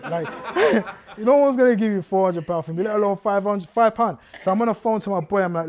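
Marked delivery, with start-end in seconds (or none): 0.0-4.2 loud laughing, higher and louder through the words; laughing in disbelief. Real, not acted. Preacher to the congregation, mocking the ransom, laughing loudly. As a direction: laugh out the absurd ransom sum.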